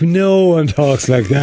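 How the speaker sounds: mock Irish scoff. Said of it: "and we're like".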